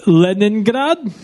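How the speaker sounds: slow, hammed-up fake Russian accent; bad Russian accent. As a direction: overdo a phony Russian accent. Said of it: "that's the worst Russian accent I've ever heard".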